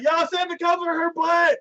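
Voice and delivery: very high mock whine; hammed-up aggrieved yelp; protesting hero. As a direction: call back defensively while fleeing. Fleeing hero protests, acted.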